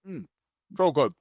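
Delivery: talking while chewing; mouth full. Real, not acted. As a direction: say it through a bite of donut. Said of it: "Sorry, I'm gonna talk with my mouth full".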